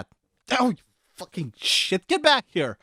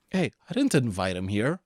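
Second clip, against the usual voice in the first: normal talking voice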